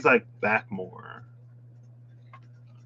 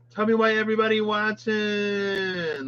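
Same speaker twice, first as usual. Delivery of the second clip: sassy disbelief; 9 semitones higher than usual. Real, not acted. Scandalised by the card.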